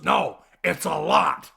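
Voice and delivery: a loud, slow Penguin snarl; Penguin impression. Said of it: "I love how he goes".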